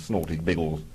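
ordinary talk, nothing marked

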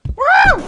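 very high, loud woo; excited whoop. Real, not acted. Player escaping in the game, high excited whoop.

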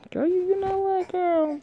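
high, drawn-out sassy scold; scolding sass. Real, not acted. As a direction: wind up to roast her, way up high. Reader scolds character.

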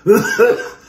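coughing and laughing; high laughing cough. Real, not acted. Inhaled pepper, coughing.

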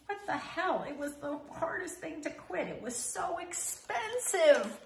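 shocked at your own thought; alarmed disbelief. Real, not acted. Catching her own urge to chew again, high alarmed disbelief.

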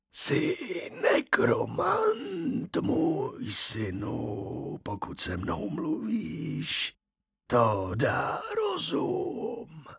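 slow, soft, breathy whisper from the grave; a dead man calling his son, slow and eerie; ghost of the dead father. A narrator's reading. Ghost speaks. (pomalý šeptavý hlas ducha mrtvého otce)

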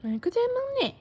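high-pitched cooing praise. Real, not acted. Parent praising feeding baby.

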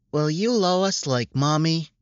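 put-on high, eager child's plea; hopeful little girl. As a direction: ask it like a hopeful small girl. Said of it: "with so much hope".

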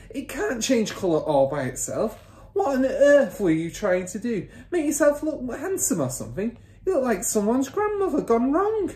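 high-pitched exasperated scolding; mother's cry. A narrator's reading. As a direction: scold him as the appalled wife. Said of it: "the mother cried".

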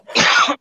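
dry cough from perfume; choking cough. Real, not acted. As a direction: cough it out, throat irritated. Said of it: "I'm choking because of the perfume".

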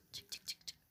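soft, tense whispered chant; hushed urging. Real, not acted. Urging game item quietly.

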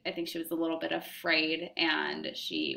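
ordinary delivery

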